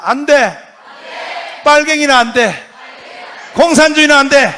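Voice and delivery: loud chant for the crowd to repeat; call-and-response shout. Shown as a demonstration. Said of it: "따라서 합니다". (청중이 따라 외치도록 선창)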